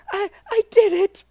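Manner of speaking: put-on the scared kid shouting his win; triumphant boy.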